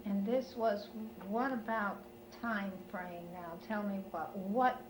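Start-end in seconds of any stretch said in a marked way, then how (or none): none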